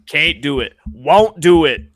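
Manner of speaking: emphatic refusal. Real, not acted. Refusing repeatedly, building.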